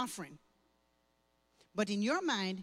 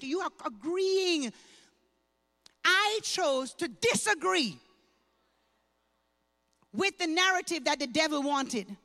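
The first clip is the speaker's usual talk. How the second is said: preaching; 5 semitones higher, 6 dB louder than usual. Acted. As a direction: hammer each I chose like a preacher. Preaching testimony, repeated declaration.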